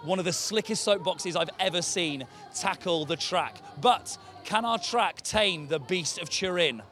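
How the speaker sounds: usual speaking voice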